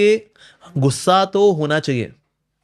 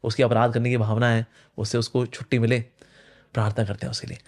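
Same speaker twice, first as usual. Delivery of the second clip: prayerful; 5 semitones lower, 5 dB quieter than usual. Shown as a demonstration. Demonstrating a prayer.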